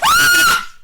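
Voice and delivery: very high, loud cartoon scream; Mario's scream. Mario startled by a Goomba, piercing mock scream. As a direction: shriek at an extreme high pitch. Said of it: "And then Mario screams again".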